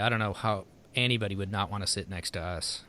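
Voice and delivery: normal talking voice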